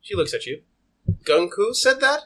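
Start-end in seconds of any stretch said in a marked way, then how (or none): 1.2-2.2 high, rising disbelief; incredulous question. Acted. Customer doubting the shopkeeper's claim, high and incredulous. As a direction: question it with your pitch climbing.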